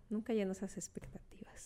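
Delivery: soft unvoiced whisper. Real, not acted. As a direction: tell it like a secret. Confides, drops to whisper.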